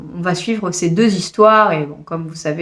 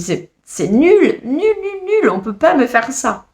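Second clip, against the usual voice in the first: slow, exasperated wail climbing much higher on the nul; half-mock despair, dragging out each nul; exasperated outburst; 7 semitones higher, slower than usual. Reviewer retelling her outburst to her boyfriend, exasperated wail. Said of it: "Je dis".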